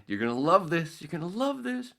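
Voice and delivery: high, gleeful sing-song tease; giddy you're gonna love this; gleeful teasing. Retold or imitated. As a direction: tease him, barely holding back laughter. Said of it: "And he goes".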